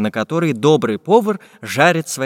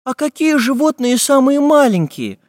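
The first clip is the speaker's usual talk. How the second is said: put-on high, loud girlish question; curious little girl; 12 semitones higher, 5 dB louder than usual. A narrator's reading. Girl asks about the smallest animals, high and curious. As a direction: ask eagerly in a high child's voice. Girl's question voiced by narrator.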